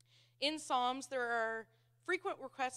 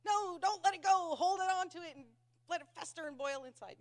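high, loud stubborn protest easing off at the end; hammed-up refusal to let it go; mock grudge-holding; 6 semitones higher, 5 dB louder than usual. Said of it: "I'm all one of these, like".

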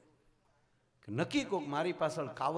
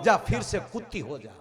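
loud, higher pronounced curse; hammed-up raised-voice curse; mock curse; 6 semitones higher, 11 dB louder than usual. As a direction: declare it like a laughing guru. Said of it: "સદગુરુએ કીધું કે".

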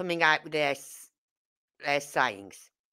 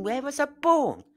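much higher, puzzled self-question; baffled inner question; 9 semitones higher than usual. Retold or imitated. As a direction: sound thrown by the silly question. Puzzled thinking on phone.

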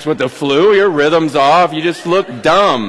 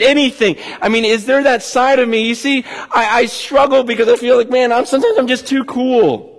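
usual speaking voice

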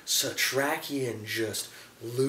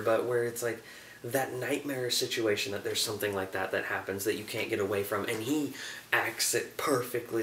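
ordinary delivery

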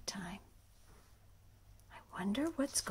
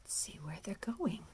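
plain reading voice

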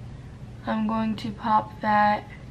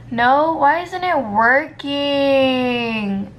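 slow, loud, higher exasperated cry; frustrated wail; 3 semitones higher, 7 dB louder, much slower than usual. Real, not acted. Fed up with the cut crease, a slow loud wail.

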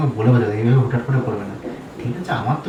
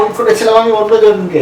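much higher and louder, insistent; shouted insistence; 9 semitones higher, 6 dB louder than usual. Acted. Husband insisting to his wife, high loud shout. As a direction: shout over her to be believed. Argument peaks.

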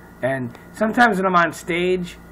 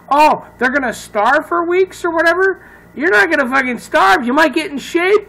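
loud, high-pitched ranting; comic outrage; 10 semitones higher, 5 dB louder than usual. Real, not acted. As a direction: rant in mock indignation, voice pushed way up. Mock outrage at show's contestants.

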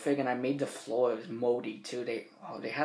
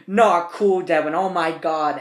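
higher, much louder exasperated cry; exasperated staff yell; 4 semitones higher, 10 dB louder than usual. Retold or imitated. Staff reacting to flooded kitchen.

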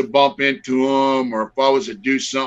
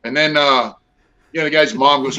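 usual speaking voice

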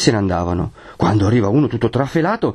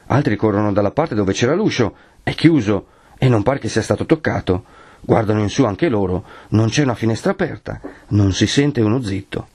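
ordinary delivery, nothing marked

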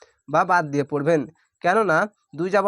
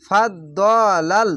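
slow demo reading; 3 dB louder, much slower than usual. Shown as a demonstration. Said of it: "teacher reads the word slowly".

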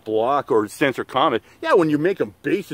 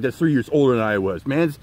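ordinary talk

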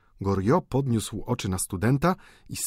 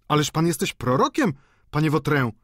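amazed exclamation; 6 semitones higher, 4 dB louder than usual. Acted. Landlady amazed at Vautrin.

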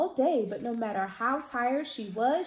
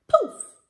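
much higher, loud bursting poof; magic poof; 9 dB louder than usual. A narrator's reading. Magic sound effect.

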